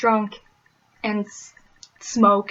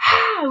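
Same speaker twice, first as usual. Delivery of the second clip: high-pitched delighted squeal; excited squeal; 13 semitones higher, 4 dB louder than usual. Retold or imitated. Midwesterners greeting a fellow Wisconsin girl, high squeal. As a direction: squeal like meeting a long-lost friend.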